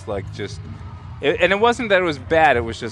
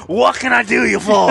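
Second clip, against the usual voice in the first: put-on a rough, half-managed Scottish accent, higher and louder; attempted Scottish bartender accent; 8 semitones higher, 8 dB louder than usual. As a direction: have a go at the Scottish barmaid's line, knowing you can't quite do the accent. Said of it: "and I can't do the accent".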